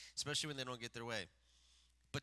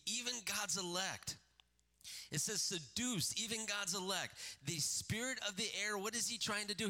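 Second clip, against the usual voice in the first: raised, higher preaching voice, pressing hard; full-force preaching; 6 semitones higher than usual. Acted. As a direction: preach it up, urging the church.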